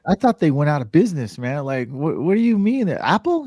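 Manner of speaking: high, loud incredulous yelp; stunned disbelief. Retold or imitated. To his friend about the Mac, a retold shocked high yelp. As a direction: shoot up in shock, can't believe it.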